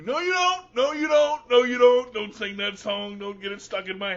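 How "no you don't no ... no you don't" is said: loud, raised-pitch objection, repeated insistently; hammed-up loud refusal; mock protest. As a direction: cut the singer off in comic alarm. Cuts off the Barney song.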